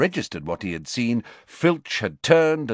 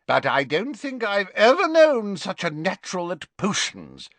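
put-on high, hearty old professor voice; a fond, tipsy potions master; jovial Slughorn; 11 semitones higher than usual. Tipsy teacher praising Harry to Trelawney, high and hearty.

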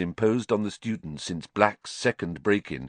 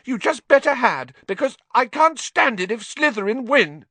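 much higher, louder, urgent; a wound-up girl insisting; shrill; 5 dB louder than usual. Acted. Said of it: "Hermione said shrilly".

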